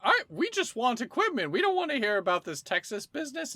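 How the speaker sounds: a fed-up shopper who wants to leave; high-pitched annoyed customer; exasperated customer. Acted. Impatient customers in the shop.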